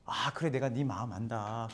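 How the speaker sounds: 다정한 위로. Retold or imitated. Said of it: "이런 게 아니라".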